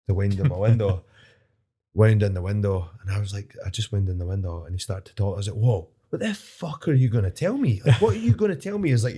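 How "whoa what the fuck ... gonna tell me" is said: indignant challenge climbing much higher; retold shouting. Driver confronting an older man, mock outraged. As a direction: build from affronted to high-pitched outrage. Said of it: "'cause I was shouting at him".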